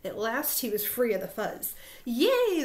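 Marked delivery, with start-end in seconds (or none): 2.1-2.6 high, loud hooray; crowd cheer. A narrator's reading. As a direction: burst out cheering. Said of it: "the crowd cheered".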